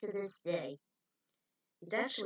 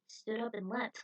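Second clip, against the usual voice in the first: ordinary delivery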